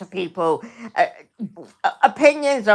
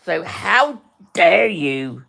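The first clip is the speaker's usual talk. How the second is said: ordinary talk, nothing marked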